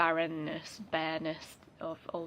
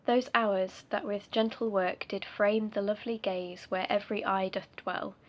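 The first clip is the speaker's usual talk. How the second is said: reciting a sonnet; 3 semitones higher, 4 dB louder than usual. Acted. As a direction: recite it like a formal poem. Reads the sonnet aloud.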